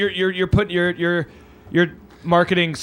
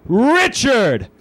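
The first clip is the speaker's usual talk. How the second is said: rising, louder ring-announcer call; drawn-out, high shouted hype intro; big announcer introduction; 5 semitones higher, 4 dB louder, slower than usual. Real, not acted. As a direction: build up to the guest's name and shout it. Host announcing the guest on stage.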